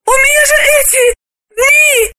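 very high, fast, loud woman's cry; high, shrill female alarm; gasping dismay. Acted. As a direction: gasp out in horror. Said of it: "Она только ахнула".

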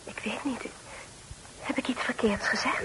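barely whispered, anxious and unsure; timid whisper. Acted. Guest fearing she said something wrong, whispering. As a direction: shrink and whisper it.

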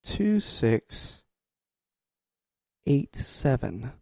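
each digit said slowly and apart; slow spelled-out digits. Shown as a demonstration. Host reading the voicemail number to listeners, slow and deliberate. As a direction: drag out two six eight seven. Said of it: "The numbers are".

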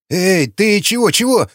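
испуганно, выше, заикаясь от страха; пленный пугается меча. A narrator's reading. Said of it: "испуганно пробубнил пленный". (пленный в испуге лепечет перед катаной)

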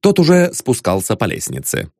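ordinary delivery, nothing marked

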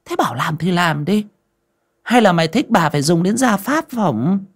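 high, mock-stern seductive mistress; teasing threat. A narrator's reading. Mistress half-threatens Kim with house punishment, high teasing. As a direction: threaten him playfully.

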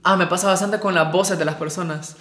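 ordinary talk, nothing marked

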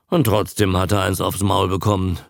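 usual speaking voice

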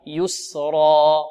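يسرا very slow, long open final a; open-throated ending. Shown as a demonstration. Teacher leading students to repeat, drawn-out open ending. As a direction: stretch يسرا and let the a fade without hamza. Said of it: "انطقوا معي".

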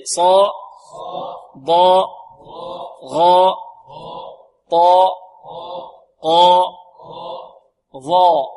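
"صا" through "ظاء" as single, full emphatic syllables without rounded lips; isolated heavy tones from "صا" to "ظاء"; heavy letters drill. Shown as a demonstration. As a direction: call out "صا", "ضاء", "غاء" firmly, pausing for echoes. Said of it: "بدون ضم الشفتين".

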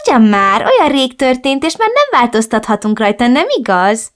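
a hint of a reproachful mother. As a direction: reproach her daughter in a lilting mother voice. Mother voiced in story.

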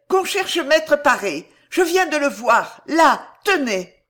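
high, loud, anxious command; urgent order. A narrator's reading. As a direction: send them running for help. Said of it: "ordonna-t-elle".

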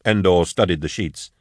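ordinary delivery, nothing marked

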